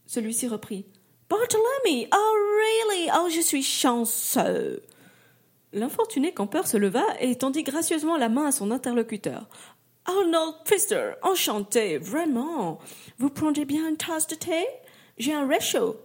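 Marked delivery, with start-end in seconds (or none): 1.3-2.1 put-on English-accented name. Englishman repeats the mayor's name, English accent. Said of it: "au fort accent anglais". 2.1-4.8 put-on high, slow delighted English-accented French. English camper delighted to meet the mayor, English accent. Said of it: "au fort accent anglais". 10.0-16.0 put-on polite Englishman's accented French, a bit higher; English accent. English camper offers the mayor tea, English accent. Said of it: "au fort accent anglais".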